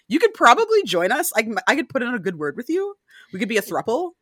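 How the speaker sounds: high, teasing voice pitching a throuple; mock seductive offer.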